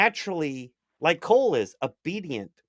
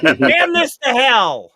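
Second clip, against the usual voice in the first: slow, higher, louder mock damning; hammed-up curse; 8 semitones higher, 8 dB louder, slower than usual. Retelling how the sleeping puppy won him, slow hammed-up curse. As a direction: drag it out like a beaten man cursing in jest. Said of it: "I'm like".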